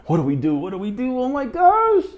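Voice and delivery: climbing into a high, loud squeal; hammed-up freak-out, ending very high; mock panic. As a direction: spiral upward into comic panic. Acts out his own panic.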